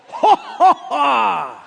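hammed-up scornful laughter; booming mock laugh. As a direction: laugh big like God in heaven. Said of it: "And he just laughs".

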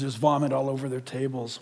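low, soft, grave reading; grave quote. Retold or imitated. The pastor quotes God's judgment quietly and low. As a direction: read the verse low and heavy. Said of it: "And he says".

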